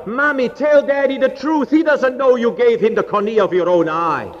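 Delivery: put-on high, pleading child's outcry; crying out. Daughter begging mother to tell father the truth, crying out. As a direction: cry it out high, urgent and desperate. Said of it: "she cried out".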